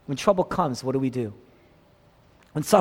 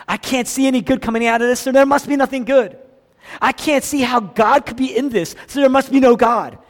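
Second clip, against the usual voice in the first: higher, louder, faster despair; despairing outcry; 8 semitones higher, 9 dB louder than usual. Retold or imitated. Preacher voices someone losing heart, rising to an anguished cry.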